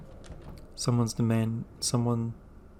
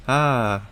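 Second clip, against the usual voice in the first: short sharp yelp of pain, louder; sudden hurt gasp-shout; pained cry; 6 dB louder than usual. Acted. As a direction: cry out as the creature pounces. Eve attacked by butterfly creature.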